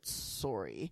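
a hint of Canadian "sorry", rounded "sore-ee"; Canadian accent. Shown as a demonstration. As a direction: slip slightly into a Canadian "sorry". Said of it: "if I heard it enough, it would be a little bit".

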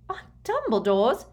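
incredulous outrage; outraged. A narrator's reading. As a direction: throw it back as an outraged question. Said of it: "in tones of outrage".

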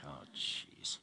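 very low, hushed reluctant groan; dismayed aside. Retold or imitated. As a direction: drop it right down, as if to yourself. Reluctant reaction to the song.

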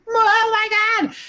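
high, slow, gasping "oh my God"; excited crowd cry. Retold or imitated. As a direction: stretch it out like a thrilled crowd. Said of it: "everybody's like".

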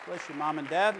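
ordinary talk, nothing marked

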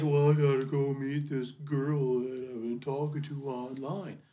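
imitating Brent. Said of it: "Brent's like".

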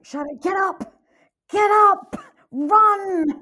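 high-pitched re-enacted scream of urging; loud, desperate cheering yell. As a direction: scream at the runner on screen. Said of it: "I was screaming".

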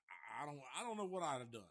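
usual speaking voice

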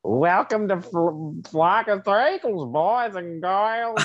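high, slow cackling ghoul host; Crypt Keeper voice. Retold or imitated. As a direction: drag it out like a creepy host. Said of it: "You're like".

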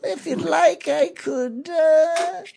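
high, slow, wheedling shopkeeper. Acted. Merchant haggling with adventurers over a pen, high put-on shopkeeper voice. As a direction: play the sly merchant squeezing a customer.

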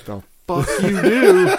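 high, loud and laughing; shocked outburst. Retold or imitated. A shocked man asks friends what they did, high laughing outburst. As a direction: blurt it out as the pain hits. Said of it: "I was like".

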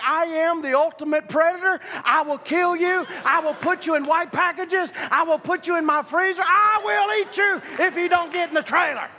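high, hammed-up war cry at a beast; mock-menacing scream building to the last threat; retold screaming. Man chasing a bull into the trailer, mock screaming. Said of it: "I'm screaming at him".

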